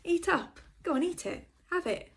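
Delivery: high, slow coaxing; nagging mother voice. Retold or imitated. Date urging him to eat up, in a high motherly coax. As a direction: push food on him like a mum. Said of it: "that's something that my mother would say".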